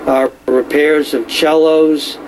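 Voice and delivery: normal talking voice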